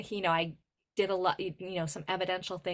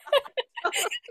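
real high-pitched burst of laughter; high loud laugh; 4 dB louder than usual. Real, not acted. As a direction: crack up at your own joke. Laughs at own joke.

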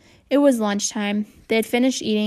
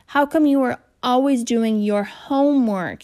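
disgusted; 3 semitones higher, 3 dB louder than usual. A narrator's reading. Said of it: "the way other people might say the word manure".

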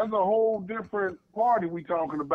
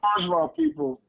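losing his voice; 11 semitones higher than usual. Real, not acted. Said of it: "I'm losing my voice".

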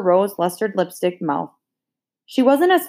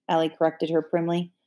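ordinary delivery

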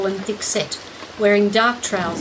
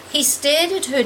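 usual speaking voice

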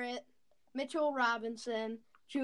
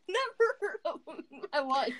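laughing; 4 semitones higher, faster than usual. Real, not acted. Laughing disbelief.

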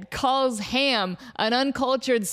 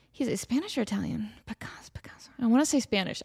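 whispered to herself; 4 dB quieter, faster than usual. Real, not acted. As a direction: whisper while thinking it over. Muttering to self, unsure.

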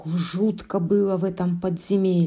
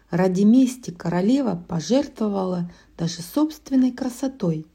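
ordinary delivery, nothing marked

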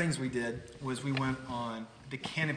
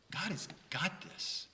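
whispered, slow "God has got this"; hushed refrain; 4 semitones higher, 6 dB quieter, slower than usual. Real, not acted. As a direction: breathe it to the congregation. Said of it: "preacher's refrain".

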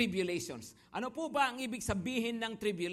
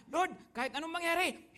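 eager promise; 9 semitones higher than usual. Retold or imitated. Disciples promise never to leave.

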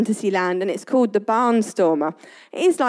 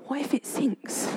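frightened whisper; scared whisper; 2 semitones higher, 11 dB quieter than usual. Retold or imitated. Son whispering his fear of sinking to his parents. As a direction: breathe it out, afraid the boat will sink. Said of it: "He was like".

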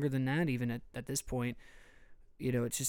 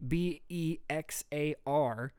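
slow, separate letters; spelled out; 3 semitones higher, much slower than usual. Shown as a demonstration. Host spelling a county name for outsiders, slow spelling.

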